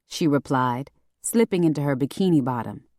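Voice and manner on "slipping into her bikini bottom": usual speaking voice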